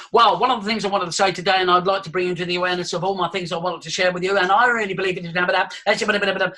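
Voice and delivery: rapid-fire, unbroken monotone patter; fast run-on babble with no pauses; rattled off. Shown as a demonstration. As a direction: rattle it out like a machine gun. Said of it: "rattle off like a machine gun".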